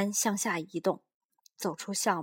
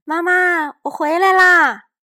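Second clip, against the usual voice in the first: put-on high, loud child calling home; boy calling to his mother; 9 semitones higher, 15 dB louder than usual. A narrator's reading. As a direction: shout to mom across the house. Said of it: "小东尼高声喊".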